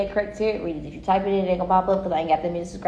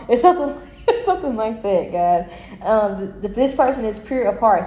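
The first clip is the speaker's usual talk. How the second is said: usual speaking voice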